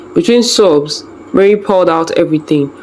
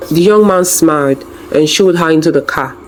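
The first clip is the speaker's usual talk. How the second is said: ordinary delivery, nothing marked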